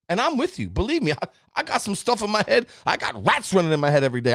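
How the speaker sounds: ordinary delivery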